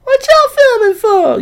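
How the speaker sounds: very high, loud mocking squeal; piercing mock-indignant falsetto; mock outraged complainer. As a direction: yell it high and drawn out, hammed-up. Mimics same complainer a second time.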